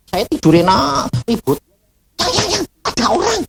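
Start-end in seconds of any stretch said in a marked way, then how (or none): 2.2-3.4 high, hurried hushed alarm; retold urgent alarm. Wife warns husband of people in the house, urgent alarm. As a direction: urgently rouse your sleeping partner, pitched high. Wife wakes him about intruders.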